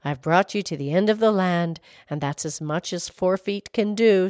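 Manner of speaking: low-pitched storybook horse. A narrator's reading. Horse at the end of the land, low voice. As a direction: speak low as the horse signing off.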